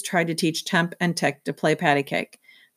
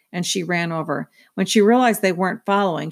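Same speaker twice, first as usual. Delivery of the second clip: ordinary delivery, nothing marked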